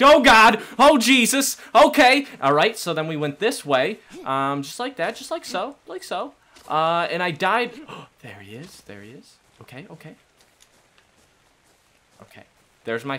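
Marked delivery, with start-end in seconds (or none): 0.0-2.3 loud, high mock-terrified shouts; reenacted panicked screaming. Player retracing their death, reenacting the screams. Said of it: "I started screaming. Let's reenact". 8.2-12.4 very soft, cautious undertone; hushed alarm. Real, not acted. Player spotting the dinosaur nearby, hushed and wary. Spots the dinosaur that killed them.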